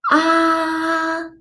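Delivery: long "ah" held high and level; high flat tone. Shown as a demonstration. Patient saying ah for dentist, "ah" high and level. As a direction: open wide and sustain a high "ah". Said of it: "That is the first tone".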